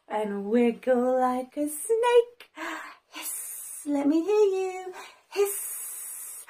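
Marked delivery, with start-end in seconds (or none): 3.1-3.8 long, soft hissing S; snake hiss. Shown as a demonstration. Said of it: "Can you hiss". 5.3-6.4 soft, drawn-out sibilant hiss; snake hiss. Shown as a demonstration. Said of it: "sound just like a snake".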